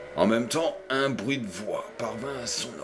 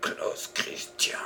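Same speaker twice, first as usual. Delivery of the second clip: horrified whisper; slower than usual. A narrator's reading. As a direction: breathe the name in dread. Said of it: "murmura le détective épouvanté". (murmure épouvanté)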